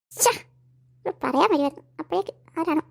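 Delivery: ordinary delivery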